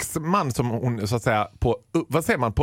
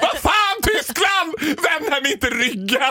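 hammed-up, much higher and slow, outraged at the whole country; mock outraged shout; 11 semitones higher, slower than usual. Fan mocking Germany for turning its back, hammed-up shout. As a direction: play the betrayed fan, bellowing.

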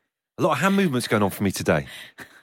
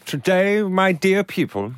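mock-posh slow proclamation; grand, plummy announcement; 7 semitones higher, slower than usual. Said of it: "I sounded really posh then".

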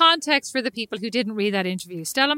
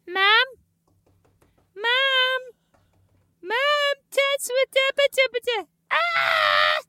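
put-on kid calling mom; 14 semitones higher, much slower than usual. It's like.

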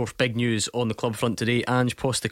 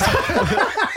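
high burst of laughter; 4 dB louder than usual. Real, not acted. Host cracks up at accent.

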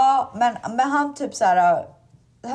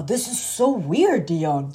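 Santa voice; 3 semitones lower, slower than usual. Retold or imitated. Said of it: "Så han bara".

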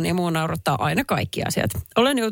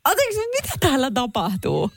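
mock indignation; 6 semitones higher than usual. Mock outraged indignant questions.

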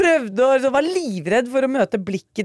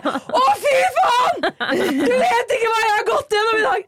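much higher, half laughing, half crying yell; exasperated outburst; 12 semitones higher than usual. Retold or imitated. Stressed mother vents at a passing cyclist, laughing, tearful outburst. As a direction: blow up at a stranger after a rough morning. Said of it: "Og jeg bare".

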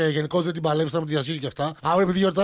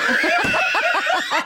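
high shrieking laugh; real laughing fit. Real, not acted. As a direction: crack up completely at the joke. Bursts out laughing.

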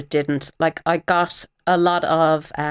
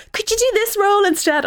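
mock casting director. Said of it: "they then go".

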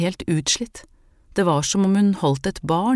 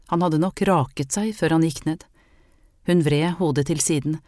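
ordinary delivery, nothing marked

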